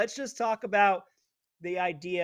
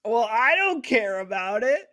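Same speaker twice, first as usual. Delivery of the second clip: mocking her dismissal; 3 semitones higher, 5 dB louder than usual. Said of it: "He laid it out there right for you".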